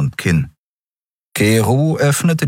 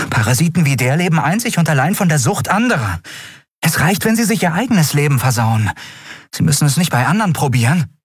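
heated contempt; 8 semitones higher, faster than usual. Acted. Endriel rants about drug dealers.

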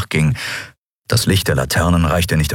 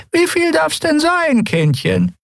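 put-on old woman voice; slower than usual. Old chestnut seller speaks.

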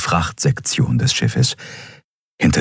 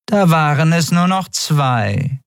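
tag character voice; 10 semitones higher, slower than usual. A narrator's reading. Female character's remark after an explosion.

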